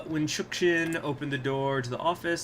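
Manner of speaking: ordinary talk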